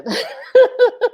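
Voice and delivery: short high laugh through tears; high giggle. Real, not acted. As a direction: giggle at yourself. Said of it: "I'm tripping".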